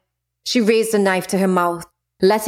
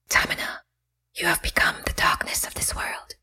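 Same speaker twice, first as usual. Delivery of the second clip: soft unvoiced whisper; 8 dB quieter than usual. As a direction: breathe it like a spirit. Goddess speaks from beyond.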